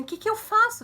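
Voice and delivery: imitating a panicked aunt, high and loud; desperate. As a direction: act out a desperate plea for help. (tia desesperada, voz aguda e alta)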